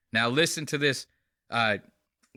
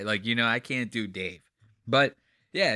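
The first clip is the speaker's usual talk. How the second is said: ordinary talk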